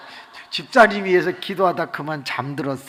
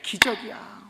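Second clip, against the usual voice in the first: normal talking voice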